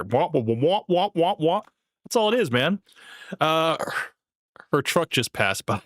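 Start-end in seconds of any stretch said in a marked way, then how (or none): 0.0-1.6 muffled trombone-like wah wah; Charlie Brown teacher. Retold or imitated. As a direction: mimic the droning adult voice from Peanuts. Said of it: "like Charlie Brown's school teacher".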